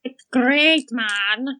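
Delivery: put-on Scottish accent, said with a grin. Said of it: "Scottish accent".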